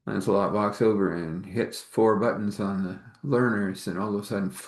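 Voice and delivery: normal talking voice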